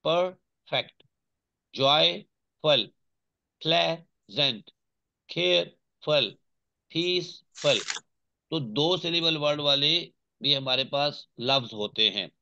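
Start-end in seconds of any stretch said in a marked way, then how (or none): 0.1-7.8 slow "perfect, joyful, pleasant, careful, peaceful", each broken in two with a clear pause inside; teacher sounding out "perfect, joyful, pleasant, careful, peaceful" in two separate beats each; split into two syllables. Shown as a demonstration. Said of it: "जिसके दो टुकड़े हो जाए". ("Perfect, joyful, pleasant, careful, peaceful" हर लफ्ज के दो टुकड़े, बीच में ठहराव)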